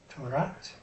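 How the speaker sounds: normal talking voice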